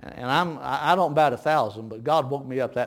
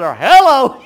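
laughing mock phone answer; 9 semitones higher, 16 dB louder than usual. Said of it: "He said".

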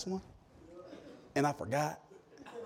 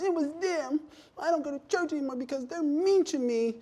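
whiny churchgoer; 10 semitones higher, 5 dB quieter than usual. Retold or imitated. Mocking a churchgoer's excuse.

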